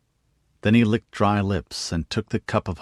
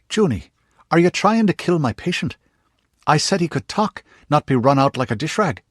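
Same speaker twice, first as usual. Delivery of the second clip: high, loud scolding healer; a sharp, indignant aunt scolding Juniper for tiring her patient; raised, annoyed older woman; Aunt Judy scolding; 8 semitones higher, 4 dB louder than usual. A narrator's reading.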